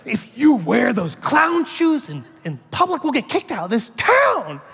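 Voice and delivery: a hammed-up wife telling him off; wife scolding. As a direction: lay down the law like an exasperated wife. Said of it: "And she goes".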